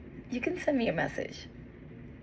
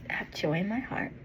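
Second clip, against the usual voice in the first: normal talking voice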